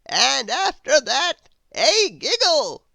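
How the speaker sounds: high, slow, loud wizard; a grand old wizard, astonished. Acted. The Wizard puzzled at Grizzle's noises, high wizard voice.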